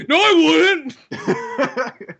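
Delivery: loud, high, drawn-out laugh through the words; laughing it off loudly as they tease him; laughing denial. Real, not acted. Friend laughs off a crude joke on the podcast, drawn-out laughing protest.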